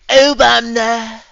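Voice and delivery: high, loud, slow mock Trump yell; Trump impression. Streamer retelling a political meme, hammed-up Trump shout. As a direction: bellow the name like a rally meme. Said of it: "where it's like Trump".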